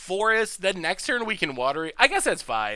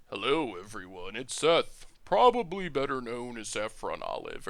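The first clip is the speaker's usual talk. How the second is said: low, soft golf commentary voice; hushed, lower announcer murmur; NPR golf announcer; 7 semitones lower, 5 dB quieter than usual. Shown as a demonstration. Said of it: "or talk like a N P R golf announcer".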